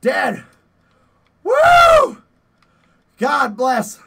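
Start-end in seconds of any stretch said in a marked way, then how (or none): 1.4-2.2 very high, loud whoop of triumph; cheering whoop. Real, not acted. Gamer celebrating a kill, loud whoop. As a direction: let out a victory whoop.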